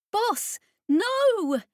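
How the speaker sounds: high, loud shout of alarm; desperate cry. Acted. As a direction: yell to stop him. Jane cries out as he activates.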